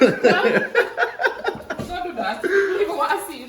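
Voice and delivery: laughing. Real, not acted. Laughing, protesting the camera.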